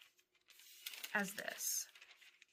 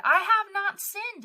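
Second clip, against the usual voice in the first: loud, high protest of innocence, acted out; imitated indignant wail, pitched way up; crying out; 11 semitones higher, 10 dB louder than usual. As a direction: cry it out in Job's defence. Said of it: "he cried and prayed and said".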